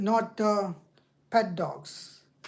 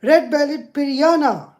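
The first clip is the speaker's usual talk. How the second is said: loud, higher-pitched exasperation; frustrated; 5 semitones higher, 7 dB louder than usual. Real, not acted. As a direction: lose patience with the search. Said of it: "It's so frustrating".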